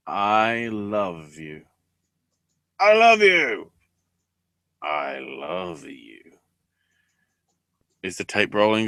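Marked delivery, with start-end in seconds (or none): 0.1-1.6 dragged-out, slow mock "I love you"; slow mock declaration. Mocking a reality show couple, slow drawn-out "I love you". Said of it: "And it's like". 2.8-3.7 hammed-up, high and loud "I love you"; mock loud declaration. Mocking a reality show couple, a loud high hammed "I love you". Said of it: "And it's like". 4.8-6.2 low, soft, slow mock "I love you"; mock whispery declaration. Mocking a reality show couple, a low soft crooned "I love you". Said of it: "And it's like".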